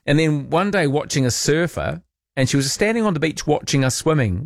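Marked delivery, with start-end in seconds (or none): none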